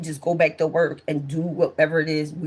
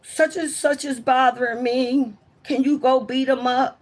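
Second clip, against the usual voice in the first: higher-pitched imagined plea; pleading to a sister; 6 semitones higher than usual. Retold or imitated. Child asking a sister to beat someone up, higher-pitched imitation. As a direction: voice a kid asking big sister for backup, pitched up. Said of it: "I didn't have a sister to be like".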